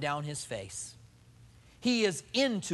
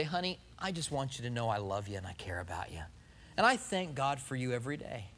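much softer, lower loving words; mean every word to your wife; husband speaking softly from the heart; sincere and tender; 6 semitones lower, 6 dB quieter than usual. Pretend. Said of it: "now you've said something with passion".

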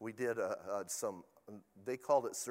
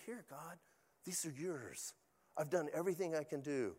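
quiet surrender prayer; 2 semitones higher, 7 dB quieter than usual. Retold or imitated. Pastor handing people to God.

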